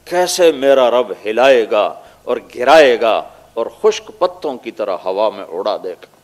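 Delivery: usual speaking voice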